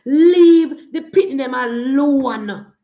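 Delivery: loud, slow, forceful demand; loud slow warning to the critics. Real, not acted. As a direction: bang it out slowly and loud. Angry plea to critics.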